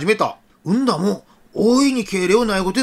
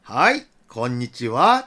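low, slow, kindly hello; gentle voice; 7 semitones lower, slower than usual. Acted. Officer answering children over car speaker, gentle voice. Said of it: "優しか声で".

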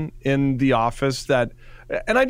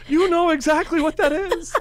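mock British accent; 14 semitones higher than usual. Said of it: "You're like".

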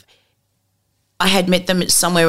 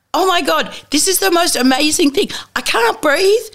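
higher, thrilled, gasping for air; breathless excitement; 11 semitones higher than usual. Retold or imitated. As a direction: sell the wonder of the summit. Climber calling from Everest summit.

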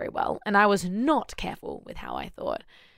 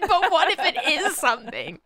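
much higher, laughing, fretful; laughing high-pitched mock worry; mock anxious whine; 9 semitones higher than usual. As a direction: squeak it like a worried girlfriend. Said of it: "And I'm like".

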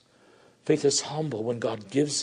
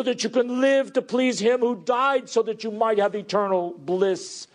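raised, high-pitched sermon delivery; impassioned preaching; 9 semitones higher, 4 dB louder than usual. Acted. Preacher pleading with children to value God's word, impassioned preaching. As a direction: preach with sustained urgency.

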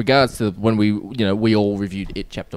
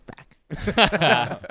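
hint of a creepy clown, higher; 6 semitones higher than usual. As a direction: toss it out like a sly clown. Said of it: "Pennywise goes".

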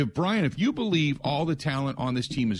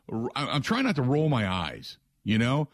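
usual speaking voice

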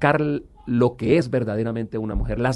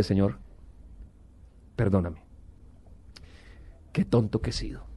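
humble prayer; 5 semitones lower, 8 dB quieter than usual. Retold or imitated. Praying on his knees in church.

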